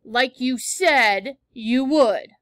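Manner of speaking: slow loud spite at mom; spiteful. A narrator's reading. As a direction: drag it out spitefully. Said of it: "I said spitefully".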